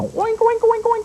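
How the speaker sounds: put-on playful high oinking for the children; pig oinks. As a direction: oink like a little pig for the kids. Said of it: "just like a pig".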